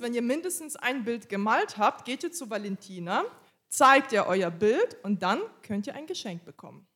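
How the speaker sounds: ordinary talk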